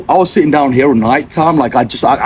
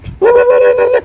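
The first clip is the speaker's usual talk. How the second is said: high burst of laughter. Real, not acted. Laughs after his own punchline.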